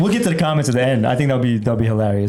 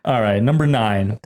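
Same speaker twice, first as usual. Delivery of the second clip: normal talking voice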